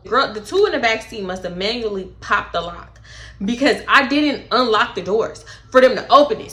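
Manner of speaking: ordinary delivery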